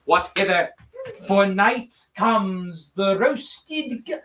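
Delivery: slow, low mock English stage voice; English Shakespeare accent. Actor performing Shakespeare in the park, slow English stage delivery. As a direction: declaim it like bad Shakespeare in the park. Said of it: "with a real English accent".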